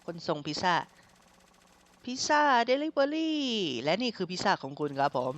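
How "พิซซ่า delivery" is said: high, very slow drawn-out call of "delivery". Acted. Delivery man announcing the pizza at the door, high and drawn out. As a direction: sing it out through the door like a delivery guy.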